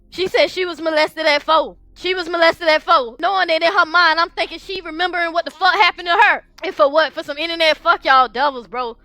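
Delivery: high-pitched, pained venting through tears; real tearful distress. Real, not acted. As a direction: pour out hurt and rage, voice pitched high. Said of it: "I'm crying because in my mind".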